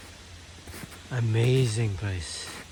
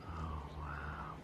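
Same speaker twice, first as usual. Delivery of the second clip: whispering; 9 semitones lower, 16 dB quieter than usual. Real, not acted. Hushed awe at the view.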